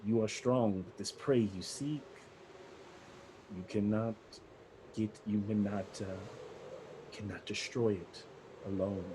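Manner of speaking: a hint of a low, quiet vampire lord; softly voiced dark lord; ominous warning. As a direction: warn them gravely, softly. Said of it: "the last thing he kinda says is this".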